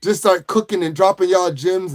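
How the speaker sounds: usual speaking voice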